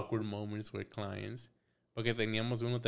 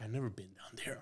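whispered aside; 7 dB quieter, faster than usual. Retold or imitated. Aside while stunned by request.

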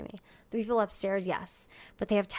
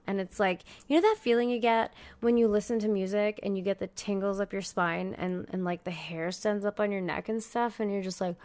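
ordinary talk

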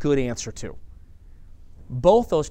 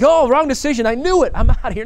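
much higher and louder, hammed-up alarm; hammed-up panicked outburst; mock panic; 9 semitones higher, 9 dB louder than usual. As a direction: overreact with a laugh as if quitting. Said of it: "I'm not like".

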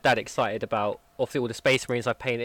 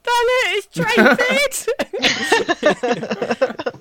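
high, loud gleeful shout with laughter; excited outburst; 8 dB louder, much slower than usual. Retold or imitated. Painter showing dad the model, gleefully excited. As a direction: burst out with it, much higher and louder. Acting out delighted announcement to dad.

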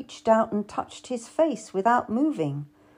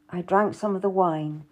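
usual speaking voice

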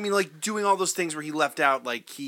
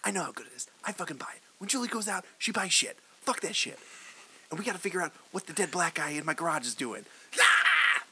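ranting; 3 semitones higher, 7 dB quieter than usual. Retold or imitated. Acting Pulp Fiction coffee rant.

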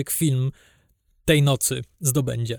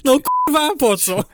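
much higher and louder, swearing in real frustration; exasperated outburst; 11 semitones higher, 5 dB louder than usual. Real, not acted. Podcaster ranting to his co-host about lazy Oscar montages, a real frustrated outburst. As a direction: throw up your hands in disbelief.

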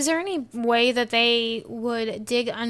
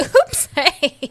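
high, loud, playful mock surprise; startled tunneller; 11 semitones higher, 12 dB louder, much slower than usual. Soldier who accidentally tunnels into enemy trench, mock surprise. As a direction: pop up sheepishly through someone's wall.

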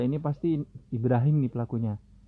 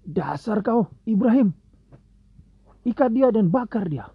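angry shout; 9 semitones higher, 6 dB louder than usual. Acted. Angry crowd orders Ibrahim burned.

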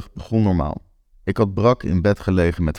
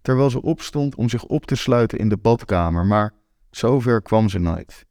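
usual speaking voice

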